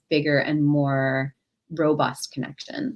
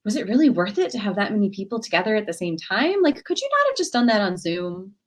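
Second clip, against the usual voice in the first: high, doubtful, deflating sister; mock-skeptical, raising each worry; joy thief; 6 semitones higher than usual. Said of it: "she could be a joy thief ... just pointing out the negative".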